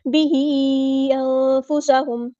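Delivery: slow melodic chant, drawn out; Quran recitation. Shown as a demonstration. Teacher models the verse for students, chanting. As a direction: intone the verse like a qari.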